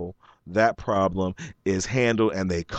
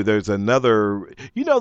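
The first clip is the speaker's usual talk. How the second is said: ordinary talk, nothing marked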